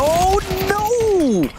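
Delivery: sudden high cry of dismay; excited shout. Real, not acted. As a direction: yelp it in shock. Caster reacting to a play.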